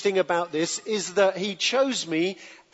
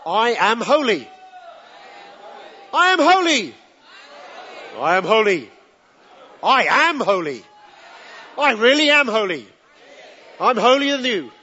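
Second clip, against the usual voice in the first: proclaiming louder; 3 semitones higher, 5 dB louder than usual. Pretend. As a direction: proclaim it like a chant, getting smug. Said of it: "let's park on this a bit more".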